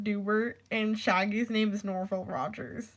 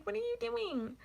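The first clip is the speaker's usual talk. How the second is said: mock whine; 13 semitones higher, 6 dB quieter than usual. Exasperated at ex not listening.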